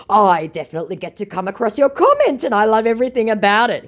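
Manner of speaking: high, loud, fast mock scammer. Mocking scammer's gushing opener, high and fast. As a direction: gush like a fake fan. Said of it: "he says".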